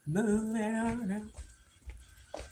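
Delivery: long slow sing-song urging; drawn-out coaxing. Real, not acted. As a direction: stretch every word to coax the dogs along.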